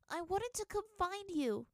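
put-on girl weakly answering her father; weak little girl. A narrator's reading. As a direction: sound small and frightened.

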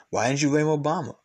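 ordinary talk

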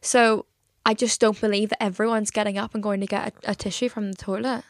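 ordinary talk, nothing marked

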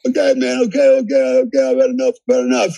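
high pleading whimper of giving up; imitating the beaten guy crying uncle; mock surrender. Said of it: "he just goes fetal on me".

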